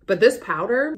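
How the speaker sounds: ordinary delivery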